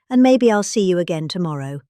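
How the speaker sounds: normal talking voice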